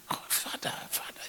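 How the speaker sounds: hushed, almost silent calling out; whispered longing. Acted. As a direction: breathe the words like a longing sigh.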